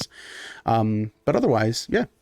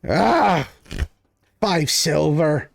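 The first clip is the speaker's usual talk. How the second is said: high, drawn-out, satisfied gloat; the winner collects his bet, slow and smug; gloating drunk; 3 semitones higher, much slower than usual. Acted. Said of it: "He goes".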